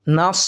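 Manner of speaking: নাস with a heavy, full-mouthed স; heavy sad sound. Shown as a demonstration. Teacher modelling the correct নাস. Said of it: "উচ্চারণটা হবে নাস".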